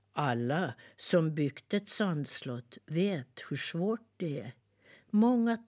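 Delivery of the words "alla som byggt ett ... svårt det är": usual speaking voice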